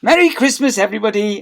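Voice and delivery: high, loud cheery greeting; announcing over din. A narrator's reading. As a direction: call out over a noisy hall. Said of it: "announced Miss Stint over the din".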